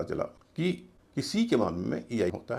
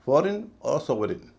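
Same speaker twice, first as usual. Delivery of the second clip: Foreign और sovereign slowly, stressed on FOR and SOV; first-syllable stress; 3 semitones higher, slower than usual. Shown as a demonstration. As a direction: hit each first syllable in foreign और sovereign, light "rin" endings. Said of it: "पहले syllable पे जोर है". (Foreign और sovereign, पहले syllable पर ज़ोर)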